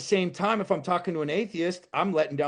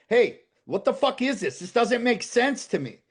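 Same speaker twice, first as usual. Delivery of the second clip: raised, higher voice railing at God; angry venting; 6 semitones higher, 4 dB louder than usual. Retold or imitated. Man venting his anger at God in honest prayer, raised and heated. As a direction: let the frustration out loud.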